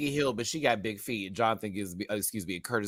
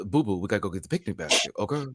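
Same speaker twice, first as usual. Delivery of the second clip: Yogi Bear impression; 5 semitones lower, 4 dB quieter, faster than usual.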